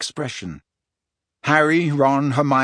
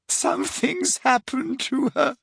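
put-on high, tearful girl's voice; a friend sobbing in panic; 11 semitones higher than usual. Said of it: "sobbed Leanne".

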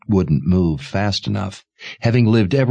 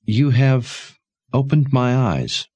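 slow, understated regret; softly pained; 6 semitones higher, slower than usual. A narrator's reading. Mother conceding to Sebastian, mildly pained and regretful. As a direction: concede slowly, a touch of pain. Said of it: "Her voice came softly and laced with pain".

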